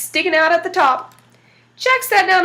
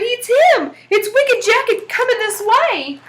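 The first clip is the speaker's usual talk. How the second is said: panicked holler; 8 semitones higher, slower than usual. Acted. The little devil shouts to his father that Wicked Jack is coming, high and panicked. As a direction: yell the alarm like a scared kid. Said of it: "he hollered".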